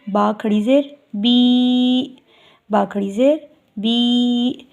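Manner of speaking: बी held twice as long, slow; long ī, two counts. Shown as a demonstration. Teacher shows standing zer, slow long बी. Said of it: "दो नुक्ते लगाने की देर में".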